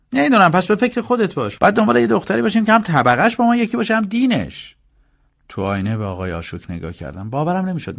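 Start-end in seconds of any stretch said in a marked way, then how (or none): 0.1-4.8 very high-pitched, pushy voice; insistent elder brother. Acted. Mukesh telling his brother to find a suitable bride, insistent. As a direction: lecture him insistently, pitched way up.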